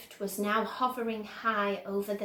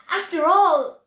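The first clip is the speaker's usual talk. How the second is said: much higher, louder brisk cry; excited cry; 9 semitones higher, 13 dB louder than usual. A narrator's reading. Wonka brushing aside Grandpa Joe's doubts, crying out. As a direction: cut them off eagerly. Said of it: "cried Mister Wonka".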